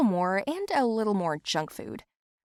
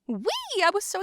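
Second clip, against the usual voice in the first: gleeful squeal; 12 semitones higher than usual. Acted. Delight at lost weight.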